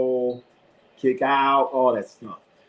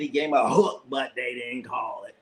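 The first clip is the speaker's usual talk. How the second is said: usual speaking voice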